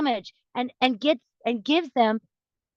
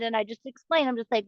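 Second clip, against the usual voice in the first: ordinary talk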